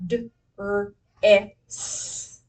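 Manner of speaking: each sound of "d, er, eh, s" said separately. Shown as a demonstration. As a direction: stretch out "d, er, eh, s" sound by sound. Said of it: "what's our final sound".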